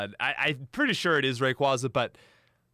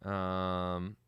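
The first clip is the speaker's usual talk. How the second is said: ordinary delivery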